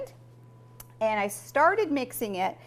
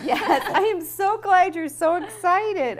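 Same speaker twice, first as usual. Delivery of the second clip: loud, laughing mock delight; excited teasing; 7 semitones higher, 6 dB louder than usual. Real, not acted. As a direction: tease with big enthusiasm. Teases kids about their excitement.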